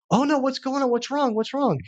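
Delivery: high-pitched worried questions, imitating her panic; alarmed new mother. Wife unaware why her baby screams, retold in high alarm. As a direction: imitate an anxious mom, voice jumping up. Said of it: "doesn't know what's going on yet".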